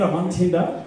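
ordinary talk, nothing marked

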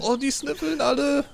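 high, drawn-out whine; dismayed wail. Real, not acted. Player laments that all predators sniff, dismayed wail.

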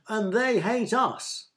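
high, slow, drawn-out protest; indignant shout. A narrator's reading. A third in the crowd joins the protest, indignant shout. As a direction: shout it with each word stretched. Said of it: "shouted a third".

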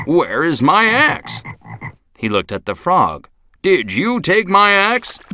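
high slow puzzled asking; puzzled lumberjack. Acted. Lumberjack searching for his lost axe, slow puzzled question. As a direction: ask slowly, high, baffled.

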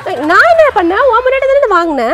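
loud, high retort; heated sibling squabble voice; indignant protest. Acted. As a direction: throw the blame back loudly. Protests blame for broken phone.

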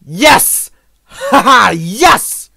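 loud, high shout of victory with a laugh; real gleeful yell; triumphant cheer. Real, not acted. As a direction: cheer as if you finally won. Celebrates success in the game.

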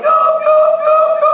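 high, loud mimicked blaring; imitated device noise. Preacher imitating the next-door man's noisy device, high mimicked blare. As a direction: mimic an annoying noise at full voice. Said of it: "That noise, it, it was so".

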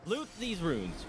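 drawn out, slow and exasperated; slow frustrated. Real, not acted. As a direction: drag it out in frustration.

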